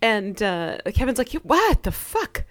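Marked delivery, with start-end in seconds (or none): 1.3-2.4 mock shocked Kevin. Kevin's shocked outburst retold.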